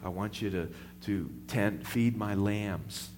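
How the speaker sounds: soft, gentle commission; tender charge. Acted. Jesus charges Peter by the seashore, soft and tender. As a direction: hand it over quietly and tenderly. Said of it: "and he says".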